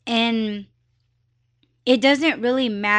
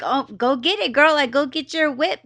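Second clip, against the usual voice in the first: lively, mildly pitched-up cheering on; happy for her; 5 semitones higher than usual. Retold or imitated. Urging a stranger to buy a car, happy for her. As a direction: egg her on with happy encouragement. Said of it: "I was just really happy for her".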